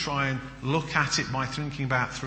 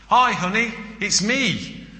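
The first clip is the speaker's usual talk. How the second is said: wife on the phone; 8 semitones higher, 5 dB louder than usual. Acted. Wife calling husband in joke.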